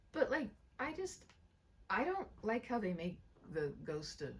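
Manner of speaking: ordinary delivery, nothing marked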